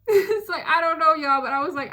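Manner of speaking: high, loud, laughing through the words. Real, not acted. Telling her viewers, laughing high and loud. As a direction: laugh while you admit it. Laughing while retelling rudeness.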